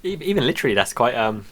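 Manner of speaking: normal talking voice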